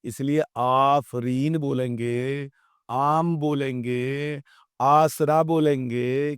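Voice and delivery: آفرین، عام، آسرا said slowly with stretched opening aa; slow teacher reading of madd words; long madd vowels. Shown as a demonstration. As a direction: draw out the first vowel of each word. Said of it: "تھوڑا سا کھینچ کر پڑھیں گے".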